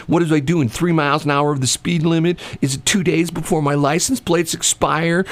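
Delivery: a lightly voiced indignant lady, ranting; retold driver mildly ranting at the cop who pulled her over; yakking driver. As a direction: rattle off complaints at the officer, mildly indignant. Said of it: "she's already just yakking at him".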